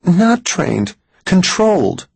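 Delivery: high, emphatic woman's voice; insistent Mary. A narrator's reading. Woman corrects Max about the gulls, insistent. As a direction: correct him in a high, urgent pitch.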